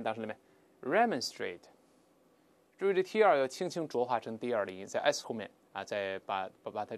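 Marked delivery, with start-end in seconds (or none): 0.8-1.6 "remonstrate" stressed on the first syllable, REM-ən-strate; British pronunciation. Shown as a demonstration. Teacher reads the British form for students, British pronunciation. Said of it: "我们像英式发音".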